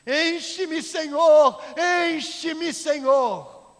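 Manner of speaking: very loud, high full-voiced shout; crying out. Man coming down the church aisle to God, crying out. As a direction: yell it out as a drawn-out plea. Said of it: "ele vinha gritando".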